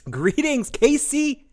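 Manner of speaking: ordinary talk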